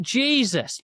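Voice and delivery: exasperated outburst. Retold or imitated. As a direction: throw it at the screen in exasperation. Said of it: "And I'm like".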